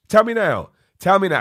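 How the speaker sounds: high, loud challenge; loud demand. Real, not acted. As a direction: call out to the viewers. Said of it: "calling on viewers".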